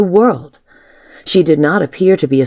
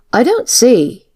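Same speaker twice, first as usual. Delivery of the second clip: higher, mildly argumentative; 8 semitones higher, slower than usual. A narrator's reading. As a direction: begin a polite objection on raised pitch. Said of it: "Miss Jenkins said argumentatively".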